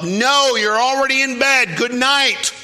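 high, loud mother's refusal; acted-out exasperated mom; yelling upstairs. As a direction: holler it up the stairs. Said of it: "yelled up".

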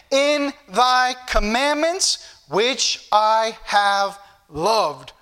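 very slow, lingering verse declamation; solemnly paced pulpit recitation; scripture reading. Real, not acted. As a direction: draw out each word of the verse. Reads scripture to congregation.

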